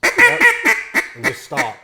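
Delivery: stretched high and slow; high slow drawn out. Shown as a demonstration. Duck stopping call demo.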